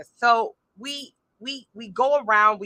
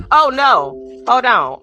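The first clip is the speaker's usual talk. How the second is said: louder, startled oh no; startled exclamation; 3 semitones higher, 10 dB louder, much slower than usual. Real, not acted. Host startled by the doorbell mid-stream, loud startled exclamation. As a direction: caught off guard, stop everything. Doorbell interrupts her livestream.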